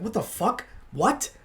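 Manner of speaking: mock indignant disbelief; upset disbelief. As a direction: act out being taken aback and upset. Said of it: "I was upset".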